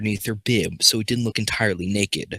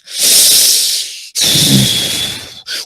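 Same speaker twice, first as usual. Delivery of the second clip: heavy sigh; 9 semitones lower, 5 dB louder than usual. Acted. Exasperated sigh in reading.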